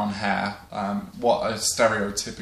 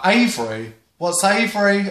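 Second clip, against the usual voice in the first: loud, higher-pitched puzzled query; hammed-up baffled asking; mock clueless question; 9 semitones higher, 6 dB louder than usual. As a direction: imitate someone who has never heard of it. Imitates people ignorant of Avebury.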